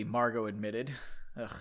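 ordinary delivery

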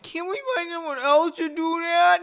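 very high, sustained whiny voice; exaggerated whine. A narrator's reading. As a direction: whine it out like a hammed-up complainer. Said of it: "he whined".

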